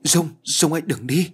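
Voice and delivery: high, acted cry after someone leaving. A narrator's reading. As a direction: call out desperately, pitched well up. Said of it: "Tôi gào lên".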